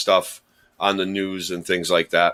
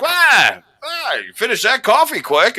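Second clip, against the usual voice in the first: much higher mock voice, teasing; Bergman's voice; 13 semitones higher than usual. Friend mimicking Bergman teasing him about coffee, high mock voice. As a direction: chirp it out like a needling buddy. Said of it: "Bergman would go like".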